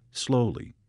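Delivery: ordinary delivery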